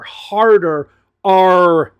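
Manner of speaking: R in "harder R" held long, loud and hard; overdone hard R. Shown as a demonstration. Teacher showing learners an overcompensated R, "R" stretched hard and loud. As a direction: lean hard into a drawn-out "R" in "harder R".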